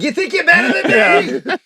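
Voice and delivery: put-on loud high Boston accent, laughing; picking a fight in a Boston accent. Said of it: "should have had a Boston accent".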